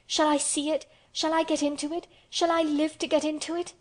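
high, urgent questions; overcome, half-sobbing plea. A narrator's reading. Weak, hysterical Colin asks about the garden, half sobbing. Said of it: "cried out with half a sob".

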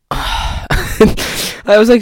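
laughing through the words. Real, not acted. Vlogger laughing at his own disappointment, laughing. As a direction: laugh it out at yourself.

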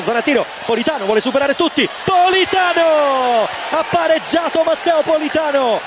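rising to a stretched shout of the scorer's name; excited goal call. Real, not acted. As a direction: yell the goal as it goes in. Goal call in live commentary.

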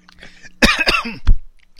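hard choking cough, something went down the wrong pipe. Real, not acted. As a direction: cough violently, choking on a drink. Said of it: "something just went down the wrong pipe".

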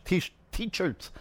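"t-shirts" with a fumbled, restarted ch; slurred S as ch. Real, not acted. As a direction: say "t-shirts" stumbling, the S sliding toward ch. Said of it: "the S turns into a ch".

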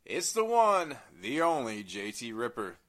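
loud announcer intro; ringmaster. Acted. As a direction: boom it out like a showman. Hosts introduce themselves.

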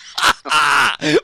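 high, loud shrieking laughter; howling laugh. Real, not acted. Host cracking up at the caller's story, high shrieking laugh. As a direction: howl with laughter.

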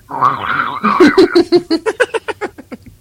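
shrill far-off demonic laugh-shout; loud cackle. Retold or imitated. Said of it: "she would be like".

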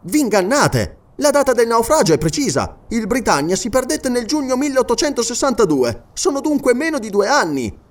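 high, projected, protesting voice; insistent correction. Acted. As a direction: argue the facts emphatically. Character corrects the sergeant.

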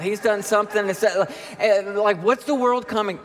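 high-pitched, escalating indignant rant; hammed-up exasperated complaining; mock outrage. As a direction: rail at the whole world over a small delay. Acts out outraged rant about delay.

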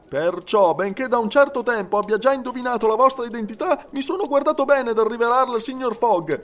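high, excitable servant's voice; indignant Frenchman. A narrator's reading. Servant telling detective he has guessed who he is, indignant.

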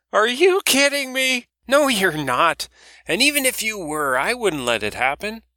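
put-on a loud old woman teasing about moping Barry; brash old woman. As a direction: rib the young man like a loud old auntie. Doris voiced by narrator.

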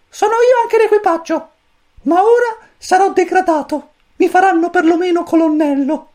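very high, squeaky little chick voice; cosmic chick voice. A narrator's reading. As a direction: chirp it like a tiny alien chick. Pulcino cosmico parla. (vocina acutissima da pulcino)

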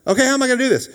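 high, loud, exasperated question; mock bewilderment. Man answering God's impossible command, mock bewilderment. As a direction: throw up a hammed-up protest.

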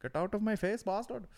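high, put-on mock-furious voice; hammed-up raging shop owner; angry shopkeeper yelling. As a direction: shoo the kids off in a high shout. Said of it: "He's like".

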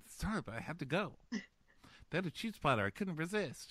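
high, softened comic apology; mock sheepish. Man apologizing from the toilet, mock sheepish. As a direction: make a pathetic little excuse. Acted sheepish excuse from the bathroom.

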